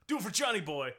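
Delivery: hammed-up urgent plea; dramatic film quote. Podcaster quotes The Outsiders to his co-host, hammed-up plea. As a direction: deliver it like an impassioned movie line.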